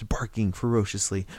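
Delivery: ordinary delivery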